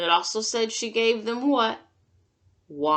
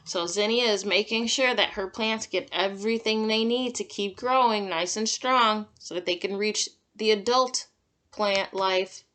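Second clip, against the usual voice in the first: plain reading voice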